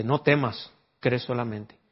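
calm command. Acted. Jesus to the grieving father, soft and steady. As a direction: quietly tell him not to fear. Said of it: "Y dice".

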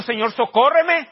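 clamor imitado, agudo y fuerte; súplica insistente. Retold or imitated. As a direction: alarga la súplica como quien insiste en pedir auxilio. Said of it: "Y le siguió diciendo". (ruego dramatizado y lento)

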